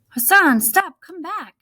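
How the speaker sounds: loud, high shout after someone running away; calling out. Acted. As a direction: yell his name across the camp.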